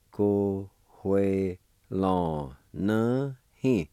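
Ko, hue, lon, ne, hin separated, rising toward hin; Cherokee syllable by syllable. Shown as a demonstration. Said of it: "it's pronounced like this".

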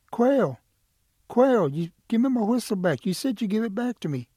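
high, loud demanding call; urgent calling out. Acted. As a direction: call after someone who took your things. Turtle calls out for Quail.

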